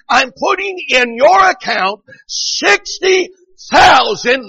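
high, slow, loud proclamation; fired-up preaching. Retold or imitated. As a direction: stretch the sum out in a pulpit shout. Preaching at full force.

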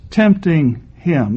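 slow, weighty "Tempting him."; slow stressed reading. Shown as a demonstration. Preacher answering the objector, slow and weighty. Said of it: "But it says".

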